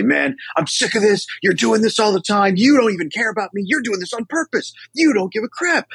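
high-pitched accusing rant, pitch climbing; avoidant partner lashing out. Retold or imitated. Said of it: "either be avoidant and say".

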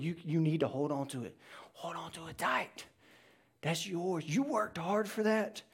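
soft, fast whispered temptation; tempting whisper. Acted. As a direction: hiss it into their ear like the tempter. The enemy tempting someone to hoard.

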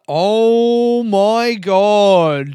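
thrilled about a sponsor, drawn out; excited drawn-out exclaim. Real, not acted. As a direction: gush with disbelief.

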